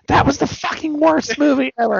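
high-pitched imitation of indignant moviegoers; mock outrage. Friends retelling their reaction leaving the theater, high mock outrage.

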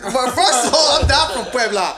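high, loud, amused objection; laughing protest. Real, not acted. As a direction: protest loudly through laughter.

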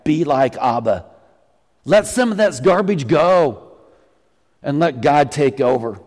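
normal talking voice

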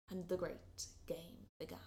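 soft, hushed and low; whispered narration. A narrator's reading. Narrator whispering as the game begins. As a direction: whisper the tension in.

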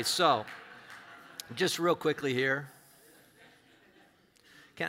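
ordinary delivery

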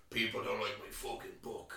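slow, hammed-up North Dublin accent; bad North Dublin accent. Mocking a film gangster author's dreadful accent, bad North Dublin accent. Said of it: "he does this accent, which is dog shit".

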